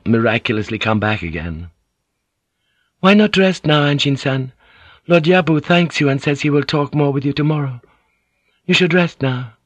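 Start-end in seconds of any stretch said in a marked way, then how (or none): 3.0-9.5 Mariko voiced. A narrator's reading. Mariko urges the exhausted Anjin-san to rest, higher and gentle. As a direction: coax a worn-out man to rest.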